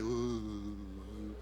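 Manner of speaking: deep, soft mock boo; low booing grunt. Shown as a demonstration. Preacher showing the congregation how to boo Barrabás, low grunt. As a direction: give a low disapproving "uh" for the congregation to copy. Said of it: "Alguien hágale".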